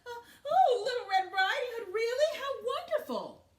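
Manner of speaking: high, loud excited granny voice; thrilled to have a visitor; grandmother's joyful high voice; delighted old grandma. Acted.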